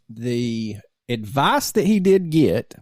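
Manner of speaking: ordinary talk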